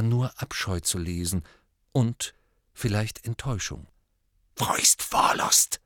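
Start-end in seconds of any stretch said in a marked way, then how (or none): 4.6-5.8 slow whispered hiss; hissing Parseltongue. Acted. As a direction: hiss the question low and cold. Riddle in Parseltongue.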